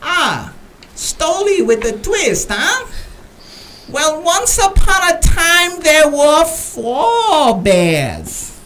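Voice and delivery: high, slow mock Chinese accent, stretched words; a broad hammed-up Chinese accent. Bartender mishearing the order, mock Chinese accent. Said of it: "he says".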